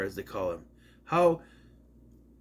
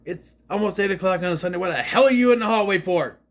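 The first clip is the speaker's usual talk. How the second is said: loud, higher-pitched annoyed outburst; real irritation; 6 semitones higher, 4 dB louder than usual. Real, not acted. Reviewer to someone in the hallway on Sunday, real irritation. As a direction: complain sharply about the noise outside.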